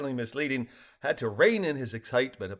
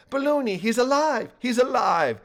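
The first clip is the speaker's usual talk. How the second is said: overjoyed; 11 semitones higher, 5 dB louder than usual. A narrator's reading. Joyful news of survival.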